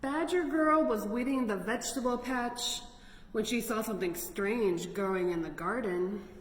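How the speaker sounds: usual speaking voice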